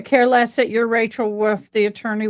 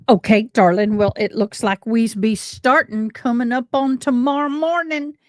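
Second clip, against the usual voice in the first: East Tennessee home accent. Shown as a demonstration. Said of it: "I get that home accent going".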